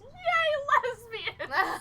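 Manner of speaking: loud, high cheering from the back row; excited cheer. Retold or imitated. A woman in the crowd cheers loudly and happily. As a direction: whoop it out like a fan. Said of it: "stands up in the back and goes".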